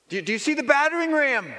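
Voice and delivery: high, barking boss voice; impatient commander calling up to the wall; boss questioning. Acted. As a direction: play the demanding boss up high.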